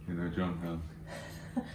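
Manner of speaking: low, soft, grave mock-serious voice; a hushed heavy "we need to talk"; imitating wife's serious tone. Husband retelling wife's ominous opener, low and hushed. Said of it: "And then she goes".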